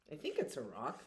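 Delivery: ordinary delivery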